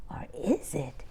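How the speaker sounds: whispered teasing question; suspense whisper. A narrator's reading. Reader teasing young listeners, hushed whisper. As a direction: whisper it like a secret to the kids.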